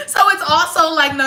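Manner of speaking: ordinary talk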